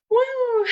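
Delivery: high, loud celebratory whoop; a big cheering "woo"; excited whoop. Real, not acted. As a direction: let out a joyful whoop. Celebrating after the repetitions.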